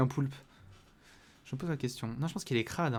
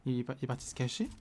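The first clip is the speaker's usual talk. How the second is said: ordinary delivery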